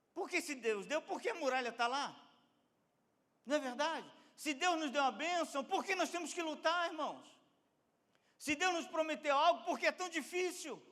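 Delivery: high, loud rhetorical challenge; impassioned preaching. Acted. Preacher asking why believers must struggle, impassioned. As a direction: press the questions on the congregation with force.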